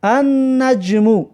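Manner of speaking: "النجم" slowly as an-najmu, doubled N; silent L. Shown as a demonstration. Teacher showing the sun letter nun, silent L in slow "النجم". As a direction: draw out "النجم" with the L merged into the N. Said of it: "شمسی هسته حرف نون".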